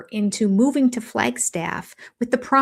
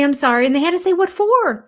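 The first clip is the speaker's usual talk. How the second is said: louder, higher, emphatic; insistent; 8 semitones higher, 5 dB louder, faster than usual. Real, not acted. Host explaining kids' apology rule, insistent and loud. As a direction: hammer home the rule.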